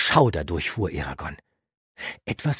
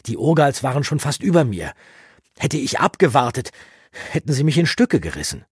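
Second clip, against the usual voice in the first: louder, higher agitated youth; heated defence; 9 semitones higher, 8 dB louder than usual. Acted. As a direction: push back hard, voice rising. Eragon verteidigt sich heftig. (aufgebracht, lauter und höher)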